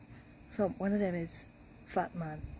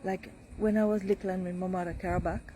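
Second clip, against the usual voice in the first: ordinary talk, nothing marked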